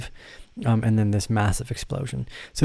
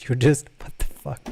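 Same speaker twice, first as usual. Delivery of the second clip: ordinary talk, nothing marked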